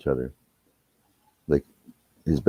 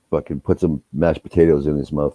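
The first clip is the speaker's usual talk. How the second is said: normal talking voice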